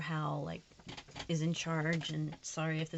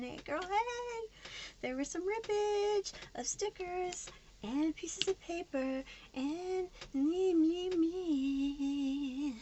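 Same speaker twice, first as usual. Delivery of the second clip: much higher, slow singsong greeting; sing-song; 10 semitones higher, 4 dB louder, much slower than usual. Acted. Vlogger back on camera greeting viewers in a high singsong. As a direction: chirp it out, playful and drawn.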